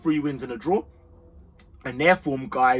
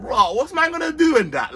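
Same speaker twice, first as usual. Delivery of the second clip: mock baffled fan; 10 semitones higher, 5 dB louder than usual. Mocking baffled fans.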